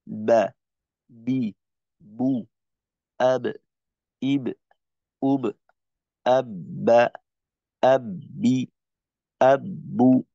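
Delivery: বা বি বু then আব ইব উব, each syllable crisp and separate; tajweed letter drills. Shown as a demonstration. ছাত্রদের শেখাতে বা বি বু, আব ইব উব স্পষ্ট আলাদা করে উচ্চারণ. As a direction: drill the Arabic ba with vowels and sukun. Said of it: "উচ্চারণটা হবে".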